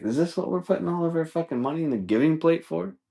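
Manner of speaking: high incredulous mock complaint; indignant aside to a partner; pissed-off churchgoer. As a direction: question it in pretend outrage. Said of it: "I'd be so fucking pissed".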